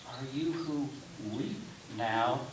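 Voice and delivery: normal talking voice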